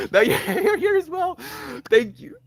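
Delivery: giggling high and loud; giddy laughing greeting. Real, not acted. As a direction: laugh through the words. Laughing at new viewer.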